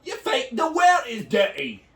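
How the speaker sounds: ordinary delivery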